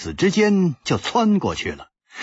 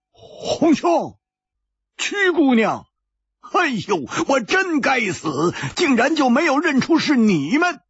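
higher, halting, sheepish; a big man fumbling for words, voice pitched up; stammering apology. A narrator's reading. Hu Tiehua apologising to old friends, high and halting. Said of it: "讷讷地说".

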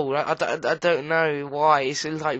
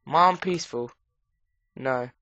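ordinary talk, nothing marked

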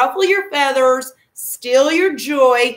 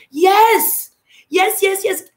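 excited yes; 6 semitones higher, much slower than usual. Real, not acted. Coach cheering on her viewers, excited. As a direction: cheer them on with a burst of yeses.

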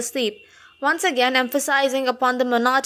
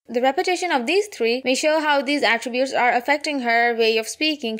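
normal talking voice

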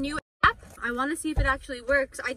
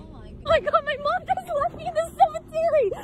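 high, frantic panic calling for mom; yelling after the car that left her; panicked calling; 12 semitones higher than usual. Real, not acted. Daughter left behind yells for her mom, panicked.

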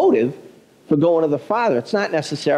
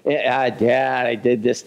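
sheepish confession; 5 semitones lower, slower than usual. Retold or imitated. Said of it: "pretend I'm confessing something".

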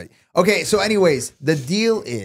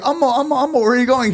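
high-pitched mocking voice; 9 semitones higher, faster than usual. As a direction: play it up in a high voice. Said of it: "she's like".

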